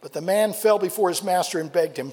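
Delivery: ordinary talk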